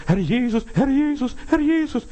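mock crying out. Preacher mimics people crying Lord without asking, mock high wail. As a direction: mimic empty cries, climbing far up in pitch. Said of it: "Herr schreien".

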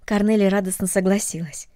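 usual speaking voice

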